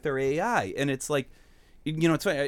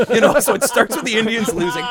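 laughing; 9 semitones higher, 10 dB louder than usual. Real, not acted. Laughing while telling bad week.

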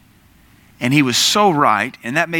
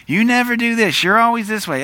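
higher, accusing mock nag; mock-upset partner, raised pitch; exaggerated spousal complaint; 9 semitones higher than usual. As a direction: play the spouse who's lost their peace. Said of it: "out of peace with your husband or wife".

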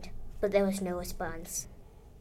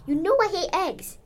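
high-pitched indignant complaint; acted kid's outburst, pitched way up; yelled; 10 semitones higher, 4 dB louder than usual. A narrator's reading. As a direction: yell your protest at dad. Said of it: "I yelled".

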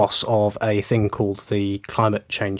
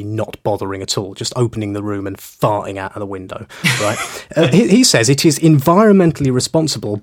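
ordinary delivery

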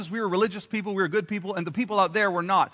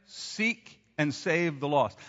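slow, softer, leading the room; slow call and response; 4 semitones lower, 4 dB quieter, much slower than usual. Shown as a demonstration. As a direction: say it slowly so all can join. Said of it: "Can we say that together?".

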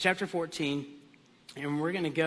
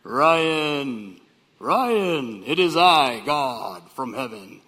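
booming, slow voice from heaven; loud, low, drawn-out divine summons; God; 5 semitones lower, 7 dB louder, much slower than usual. Acted. Preacher parodying the expected heavenly call, booming. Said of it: "a voice that's like this".

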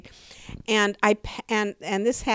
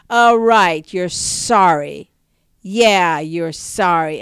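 mock scornful father, louder and slow; sarcastic father; 9 dB louder, slower than usual. Brutal father mocking the child who spilled milk, loud sarcasm. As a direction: sneer the words back at the child.

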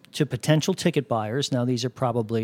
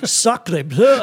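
loud mock French, pitched up; French accent; 6 semitones higher, 5 dB louder than usual. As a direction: exclaim like a cartoon Frenchman. Echoing French exclamation joke.

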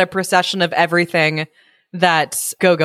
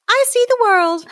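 high-pitched kitty character; cat voice. A narrator's reading. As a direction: squeak it out like a little cat. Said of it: "said the green-eyed cat".

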